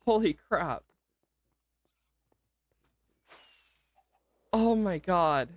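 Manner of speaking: genuinely moved and struggling to take it in; overwhelmed, near tears. Real, not acted. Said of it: "Can hear it in my voice".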